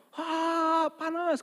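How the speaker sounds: very high pained jinn cry; jinn crying hot. Acted. Jinn in the well yelping at the ruqyah, very high. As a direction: squeal like a scorched spirit. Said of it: "kata jinnya".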